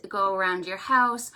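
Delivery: normal talking voice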